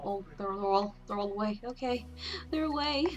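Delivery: usual speaking voice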